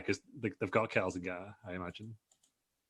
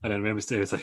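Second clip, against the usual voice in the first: ordinary delivery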